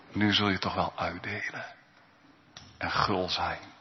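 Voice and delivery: zachte, ingetogen stem van de Geest; zacht fluisterend. Acted. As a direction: breng het zacht als een toefluisteren. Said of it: "En hij fluistert het u toe". (zacht toegefluisterd)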